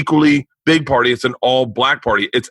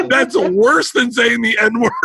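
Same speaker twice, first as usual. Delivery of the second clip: high, slow mock outrage; imitating an aghast cousin; appalled scolding; 8 semitones higher, slower than usual. Cousin scolds teller in retold exchange.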